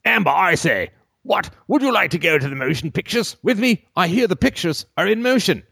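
high, formal, far-off put-on voice; grand antique suitor; old-timey gentleman.

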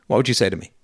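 ordinary talk

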